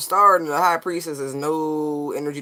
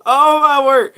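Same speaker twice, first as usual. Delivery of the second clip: loud, high exclamation; excited; 11 semitones higher, 12 dB louder than usual. Real, not acted. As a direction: burst out in delight. Delighted reaction to card.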